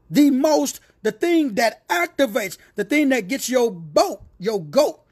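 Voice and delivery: building fervor, pitch climbing; rising preaching crescendo. Real, not acted. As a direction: preach it up to the peak.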